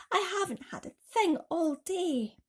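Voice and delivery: put-on pleading boy. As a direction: ask for pity. James pleads with aunts.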